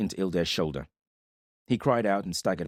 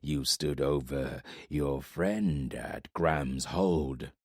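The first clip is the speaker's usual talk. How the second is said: low, very slow, heavy voice of a giant warlord; menacing warlord from the shadows; 5 semitones lower, 4 dB quieter, much slower than usual. Acted. Giant warlord speaks to Corbin from the shadows, low and slow. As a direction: let each word drop slow and low like a giant's threat. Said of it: "Ildair said, moving closer".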